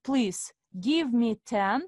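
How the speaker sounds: normal talking voice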